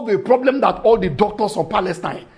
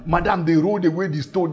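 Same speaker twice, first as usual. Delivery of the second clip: normal talking voice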